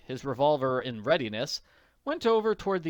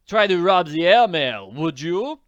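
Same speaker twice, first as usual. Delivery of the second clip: loud, higher, triumphant taunt; gloating; 6 semitones higher, 11 dB louder than usual. Acted. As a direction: crow over the caught thief. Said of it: "gloated the pilot".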